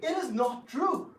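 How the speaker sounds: high, loud denial; furious high shout; angry shout. Acted. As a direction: shout him down. Denying cheating accusation.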